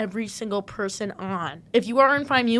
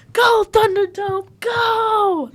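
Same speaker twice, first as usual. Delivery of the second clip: high, drawn-out sideline yell; stretched, loud rallying cry; yelled cheer; 10 semitones higher, 6 dB louder, much slower than usual. Retold or imitated. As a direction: imitate cheering a player on at full pitch. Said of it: "So then we started yelling".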